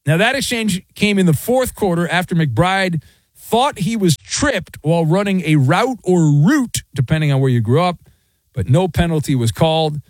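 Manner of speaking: ordinary talk, nothing marked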